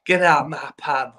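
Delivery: a hint of Cockney; Cockney attempt. Shown as a demonstration. Guest copying the host's pub line, lightly attempted Cockney. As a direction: have a tentative go at Cockney. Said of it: "can you do Cockney?".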